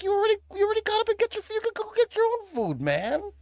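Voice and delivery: very high and fast, indignant rush; a kid complaining with his mouth full; exasperated protest. Retold or imitated. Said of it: "I was like".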